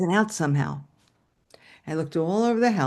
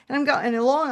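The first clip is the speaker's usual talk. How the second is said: normal talking voice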